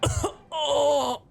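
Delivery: high, melodramatic fake death; hammed-up dying groan. Guard fakes defeat for the audience, hammed-up dying gasp. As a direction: play the dying guard way over the top.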